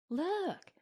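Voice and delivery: high, delighted cry at moderate volume; high-pitched thrill at a funny sight; delighted exclamation. A narrator's reading. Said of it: "exclaimed Helen in delight".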